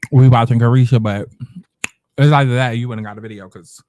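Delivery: eating while talking; mouth full. Real, not acted. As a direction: talk through a mouthful. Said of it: "I'm gonna be eating this episode".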